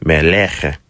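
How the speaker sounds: ordinary delivery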